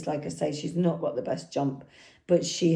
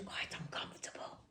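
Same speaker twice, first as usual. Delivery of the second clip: real whisper, barely audible; whispered aside; 21 dB quieter, faster than usual. Real, not acted. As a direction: drop right down into a confiding whisper. Confides the horse's drawback aside.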